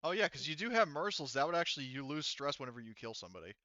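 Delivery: normal talking voice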